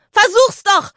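high, loud happy squeal; cheeky girl squealing with glee; gleeful shriek. A narrator's reading. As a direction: shriek it playfully at him. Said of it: "kreischte Lisa fröhlich".

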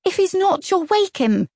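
usual speaking voice

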